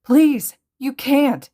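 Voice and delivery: high, loud desperate cry; tearful pleading. A narrator's reading. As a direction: plead as the tears come. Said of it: "Izuku cried, tears finally falling".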